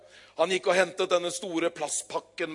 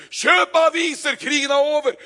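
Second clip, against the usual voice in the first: high, loud, jubilant yell; excited shouting; 10 semitones higher, 10 dB louder than usual. Acted. Newsboy shouting the war is over to the crowd. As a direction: shout the good news with joy. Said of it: "Og han roper".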